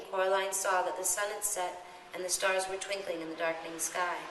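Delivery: ordinary delivery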